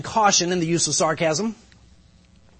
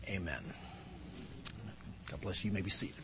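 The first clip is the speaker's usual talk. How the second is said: much softer and lower; hushed close; 8 semitones lower, 18 dB quieter, faster than usual. Real, not acted. Pastor seats congregation after prayer, hushed and low. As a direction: drop right down to a quiet, low close.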